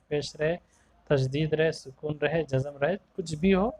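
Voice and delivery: ordinary talk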